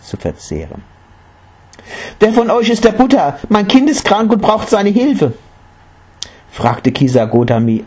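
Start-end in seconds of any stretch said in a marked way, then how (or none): 2.2-5.3 high, desperate mother's cry; urgent plea. A narrator's reading. Mother seeking the Buddha for her sick child, pleading.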